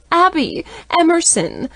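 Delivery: high, loud delighted squeal. A narrator's reading. Tipsy-happy stepmom greets the kids with a squeal. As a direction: shriek their names with giddy joy. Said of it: "Deb squeals".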